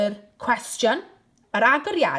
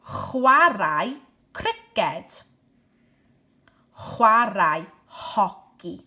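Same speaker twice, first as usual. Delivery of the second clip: slow and a bit louder, Chwarae stressed; slow drilled phrases; 3 dB louder, much slower than usual. Shown as a demonstration. Teacher drilling sports phrases slowly and clearly. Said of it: "teacher drills sport phrases".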